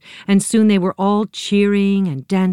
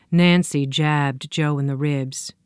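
usual speaking voice

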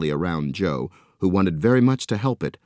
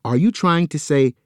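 worried Joe; 7 semitones higher, 4 dB louder than usual. A narrator's reading. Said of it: "he asked".

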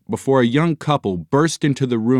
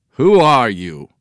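loud, pointed challenge; loud suspicious demand; 2 semitones higher, 6 dB louder, slower than usual. A narrator's reading. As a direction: demand an answer from intruders. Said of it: "Doctor Sloppy demanded".

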